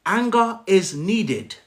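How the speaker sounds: slow, weighty, deliberate delivery; slow emphatic. Shown as a demonstration. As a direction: lean on ANGER and draw it out. Repeats key point for emphasis.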